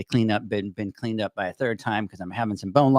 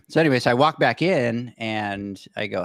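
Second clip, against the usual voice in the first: ordinary talk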